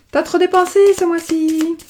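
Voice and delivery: voix de fille bien plus aiguë, pour la taquiner; imite sa fille. Retold or imitated. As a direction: singer la fille qui fait la leçon. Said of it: "qu'elle me dit toujours". (la fille qui reproche à sa mère ses dépenses, voix aiguë imitée)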